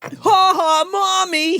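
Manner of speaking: put-on high, loud creepy kid taunt; taunting child. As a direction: crow mockingly at Mommy in a kid voice. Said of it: "He's, like, doing that the whole time".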